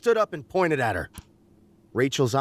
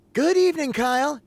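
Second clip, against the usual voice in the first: Rachel voice; 13 semitones higher, 3 dB louder than usual. A narrator's reading. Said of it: "she said".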